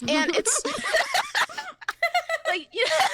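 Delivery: high, squealing laughter over the words; real laughter; laughing hard. Real, not acted. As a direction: crack up mid-sentence. Laughing at her own joke.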